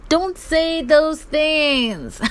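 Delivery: high, very drawn-out mimicry; slow, hammed-up mock reproach; mock Nengi. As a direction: stretch every word in exaggerated imitation. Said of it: "Did I just hear Auntie Nengi saying".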